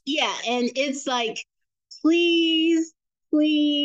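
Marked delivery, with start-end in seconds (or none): 2.0-3.8 high, drawn-out mock begging; desperate pleading. Mother reading out her son's begging messages, high mock-desperate pleading. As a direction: stretch each please into an imitated plea. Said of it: "you could hear the desperation".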